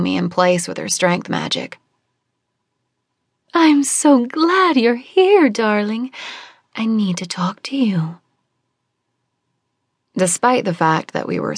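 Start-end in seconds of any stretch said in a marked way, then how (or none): 3.5-6.1 high, slow, dreamy woman's voice; sing-song and far away, savouring each word; airy, otherworldly mother figure; dreamy Celeste. Acted. 6.7-8.2 secretive whisper; hushed, conspiratorial whisper. Acted. Celeste turns secretive.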